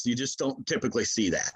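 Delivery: normal talking voice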